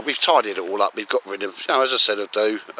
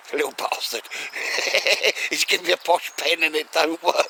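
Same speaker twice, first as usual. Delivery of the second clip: laughing through the words, higher; 7 semitones higher than usual. Retold or imitated. Vlogger laughing as he retells the broken posh pen. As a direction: giggle it out in disbelief. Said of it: "I was proper laughing".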